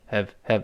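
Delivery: "have" unstressed and soft, twice; weakened HAVE. Shown as a demonstration. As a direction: reduce "have" to a light schwa. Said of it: "这个have在这儿弱化了".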